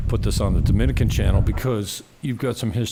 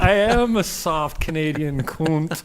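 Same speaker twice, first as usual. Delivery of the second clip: higher, louder, drawn-out mock outrage; mock protest; 6 semitones higher, 5 dB louder, slower than usual. Canadian answering his friend's sunset insult, hammed-up protest. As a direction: own the insult with loud comic emphasis.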